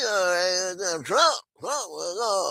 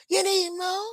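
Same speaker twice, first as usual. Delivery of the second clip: high taunting call; mock Trump offer. Trump offering Netanyahu more, a high taunting call.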